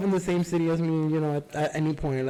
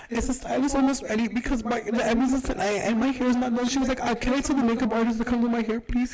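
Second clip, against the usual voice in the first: whiny high voice, much higher throughout; mocking whiny client; 7 semitones higher than usual. Stylist mimicking the Karen client begging for her hair. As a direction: mimic the panicky client pleading, pitched way up.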